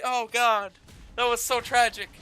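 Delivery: ordinary delivery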